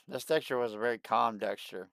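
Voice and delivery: ordinary talk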